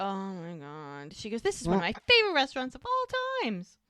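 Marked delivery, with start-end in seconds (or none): 0.0-1.1 slow, drawn-out groaning aside; mock dismay. Doc stuck listening to gushing Muriel, slow dismayed groan. 1.4-3.8 high, loud, quick mock enthusiasm; gushing. Muriel shows off to the couple at dinner, high gushing delivery.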